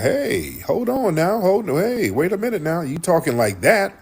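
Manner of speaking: high-pitched, imitated protest; retold confrontation. Corey challenging Anton's insult, retold in a high indignant voice.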